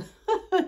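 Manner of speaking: mock opera warble, very high and loud; high Castafiore trill. Mock diva warble, poking fun at her own singing voice. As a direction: show off a diva squeal. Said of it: "vu la voix de Castafiore que j'ai".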